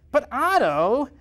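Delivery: high, nasal whine. Acted. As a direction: whine it high through the nose. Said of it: "in a more nasal voice".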